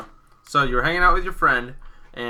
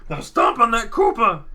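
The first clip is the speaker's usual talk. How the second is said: high, loud excited order; Toadsworth command; 9 semitones higher, 6 dB louder than usual. Acted. Toadsworth urges Marky to stomp the Koopa. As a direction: cheer him into the stomp. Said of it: "Toadsworth's like".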